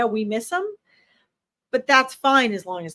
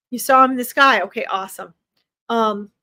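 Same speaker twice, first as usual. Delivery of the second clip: ordinary delivery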